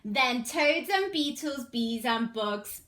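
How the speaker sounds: lively, sing-song storybook reading; animated picture-book read-aloud; reading to children. Acted. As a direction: read it bright and bouncy for little ones.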